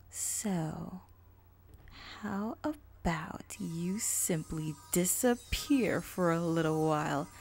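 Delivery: slow, sly whisper of a dark mare; creepy villain voice. Acted. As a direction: purr the greeting like a lurking villain. Villain threatens her.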